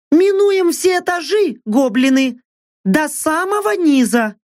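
high, excited battle call; a reckless woman leaping, calling out. A narrator's reading. Mercenary calls out while jumping. (высокий азартный клич)

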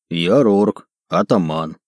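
low orc chieftain, broken speech; broken Dwarvish. Acted. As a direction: growl out his name and rank. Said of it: "на ломаном языке гномов".

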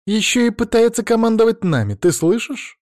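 indignant. A narrator's reading. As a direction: protest in outrage, pitched well up. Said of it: "возмутилась Софья".

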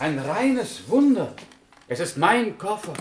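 can hardly believe it's his suitcase; delighted astonishment at a miracle; amazed joy. Acted. Gets his lost suitcase back.

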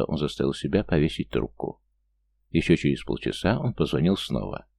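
ordinary delivery, nothing marked

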